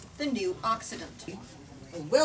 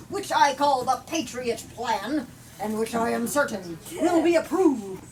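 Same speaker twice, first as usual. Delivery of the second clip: fiery political speech; 7 dB louder than usual. Acted. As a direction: thunder it at the Parliament like a demagogue. Politician continues his speech.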